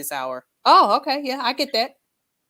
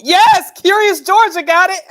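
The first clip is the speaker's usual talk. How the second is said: excited cheer; 12 semitones higher, 14 dB louder than usual. Real, not acted. Cheering a viewer's joke.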